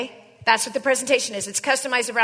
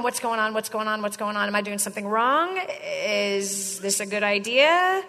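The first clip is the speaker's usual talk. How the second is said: worried prospect; 5 semitones lower, 7 dB quieter than usual. Retold or imitated. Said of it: "they're going".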